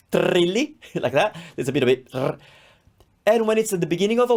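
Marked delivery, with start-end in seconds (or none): 0.1-0.8 "trilly" with the R rolled long. Shown as a demonstration. Teacher showing how double R sounds, rolled R in "trilly". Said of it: "it sounds much more". 2.1-2.4 "r-" as a short tongue-tip trill; rolled R. Shown as a demonstration. Teacher adding a sample of double R, trilled "r-". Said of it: "it does roll".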